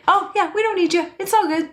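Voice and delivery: high, fast mock voice brushing someone off. Mocking the twos' fear of not being needed, high and quick. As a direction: squeak it out, a high, flippant dismissal. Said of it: "deepest fear of a two".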